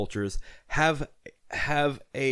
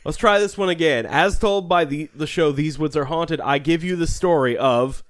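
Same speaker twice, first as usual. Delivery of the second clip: story intro narration; 3 semitones higher, 3 dB louder than usual. Acted. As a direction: announce the tale like a host.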